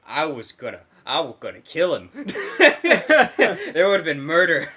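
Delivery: ordinary talk, nothing marked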